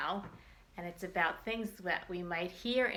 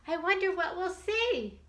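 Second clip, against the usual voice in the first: put-on reading to children; 3 semitones higher than usual. Teacher reads picture book to children.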